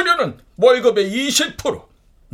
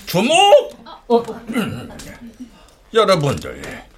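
ordinary talk, nothing marked

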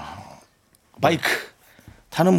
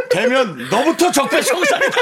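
laughing; 11 semitones higher than usual. Real, not acted. Joking accusation with laugh.

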